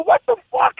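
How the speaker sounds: high, disbelieving "what the fuck" at a friend; incredulous outburst. Retold or imitated. Telling the co-host about his friend's dagger necklace, high incredulous outburst. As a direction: react in total disbelief.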